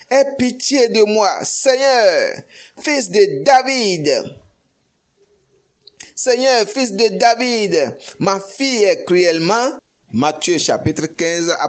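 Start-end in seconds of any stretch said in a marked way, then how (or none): 0.1-9.8 crying out. Retold or imitated. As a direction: beg the Lord at full voice. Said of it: "Lui cria". (supplication criée, aiguë et plus forte)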